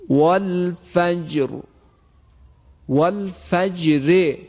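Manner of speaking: والفجر with a light, thin ر at the pause, slow; thin ra recitation. Shown as a demonstration. Teacher reciting the first verse of al-Fajr, slow. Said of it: "به این صورت هست".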